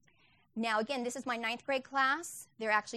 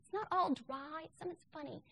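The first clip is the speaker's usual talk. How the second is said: playfully upbeat teacher line, pitched up high; mock cheerful teacher; 8 semitones higher than usual. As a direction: sell it like Latin is a joke you're in on. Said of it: "I'm like".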